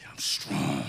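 very low, soft put-on tough voice; grandson's strongman imitation. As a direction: imitate a little kid playing strong. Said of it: "You gotta see my grandboy do that".

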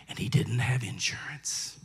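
whispered, low and hushed. Real, not acted. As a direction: drop to a grave whisper for the blow. Hushed line in a sad story.

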